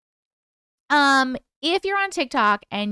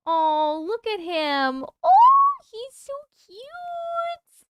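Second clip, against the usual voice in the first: cooing; 12 semitones higher, much slower than usual. Real, not acted. Coos at the service dog photo, very high and slow. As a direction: melt over a cute puppy.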